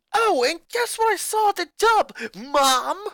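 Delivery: put-on high, loud furious boy shouting at family; a boy exploding at his mother; angry boy ranting. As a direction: snap back at Mom, furious. Lincoln taunts mother.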